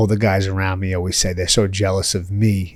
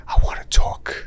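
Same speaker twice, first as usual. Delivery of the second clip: whispered into a sleeping ear; unvoiced playful whisper, imitating himself. Husband to his sleeping wife late at night, whispering. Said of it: "I whisper in her ear".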